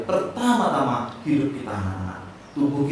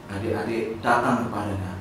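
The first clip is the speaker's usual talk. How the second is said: normal talking voice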